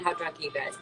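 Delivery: ordinary talk, nothing marked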